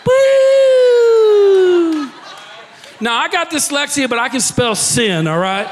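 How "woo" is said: high, loud laughing woo; whooping laugh. Real, not acted. Preacher whooping loudly at his own joke, high and loud. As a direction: let out a big joyful whoop.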